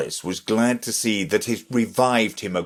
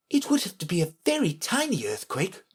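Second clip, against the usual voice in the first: put-on high, quick, light girl's voice; quick high-pitched private remark; Alice thinking; 10 semitones higher, 4 dB quieter, faster than usual. A narrator's reading. Alice privately doubting the king, sceptical. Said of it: "thought Alice".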